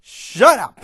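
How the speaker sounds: explosive high, loud shout; sharp, commanding yell; forceful bark. A narrator's reading. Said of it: "barks at her with force".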